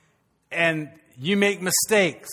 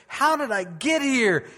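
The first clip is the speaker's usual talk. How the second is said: slow, higher, puzzled; a hint of David's dismay; lightly voiced bewildered David; 6 semitones higher, slower than usual. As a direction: wake up lost and ask slowly. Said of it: "he's like".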